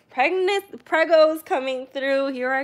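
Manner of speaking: mock announcer strutting through the house; proud announcing. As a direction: clear the way like a proud herald. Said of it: "I'll be like".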